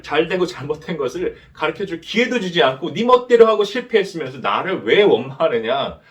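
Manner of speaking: loud, frustrated rebuke pitched well up; God fed up with Cain, almost shouting; exasperated God. Pretend. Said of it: "근데 하나님은 답답해하세요". (답답해하시는 하나님이 가인을 크게 꾸짖듯이)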